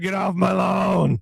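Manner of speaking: mock-cranky old man shout, drawn out slowly; slow, teasing old-geezer holler; grumpy old man. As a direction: playfully yell like an old man on his porch. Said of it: "you're taking old man naps".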